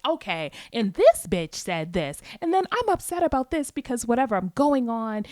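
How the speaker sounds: rattling off a gripe at lunch; mock rant. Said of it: "going off about a situation".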